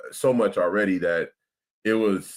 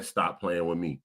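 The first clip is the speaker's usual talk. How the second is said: talking through a burp; burping the words; 4 semitones lower, 7 dB quieter than usual. Real, not acted. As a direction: burp while saying it. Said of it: "I'm burping, dog".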